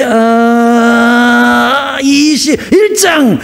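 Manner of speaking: long held filler, then high drawn-out announcement; hammed-up last-second discovery; stalling, then finding. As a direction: stall on one note, then blurt the chapter. Stalling, then suddenly finds the chapter.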